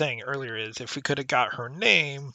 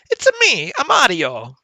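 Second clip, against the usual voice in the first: put-on over-the-top Italian accent, much higher and louder; Mario Italian accent; 10 semitones higher, 13 dB louder than usual. Gamer hearing the officer's lines as Mario, hammed-up Italian accent. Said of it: "over-the-top Italian sort of sounding accent".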